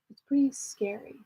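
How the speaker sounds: breathy hush on scary; spooky whisper. Pretend. Whispering to viewers about a scary theme park. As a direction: drop to a whisper to sound spooky.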